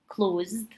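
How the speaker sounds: "closed" said as "clozd"; one syllable, "closed" ending in D; final D sound. Shown as a demonstration. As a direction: finish "closed" on D, no E vowel. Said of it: "sounds like a D and without the vowel".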